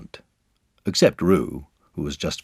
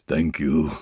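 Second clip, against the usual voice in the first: gruff little bear thanks; growled; 2 semitones lower than usual. A narrator's reading. Pooh thanking the party, growled. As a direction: growl it briefly. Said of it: "growled Pooh".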